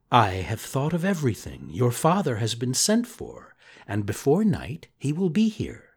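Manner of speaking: soft, gentle fairy reassurance; hushed, calming whisper at the end; soft reassuring. Acted.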